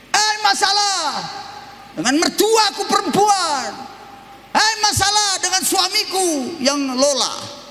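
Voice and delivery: high calling out at the problem; mock yelling about family troubles; shouted complaint. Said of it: "Teriak, saya teriak". (teriakan tinggi penuh keluhan)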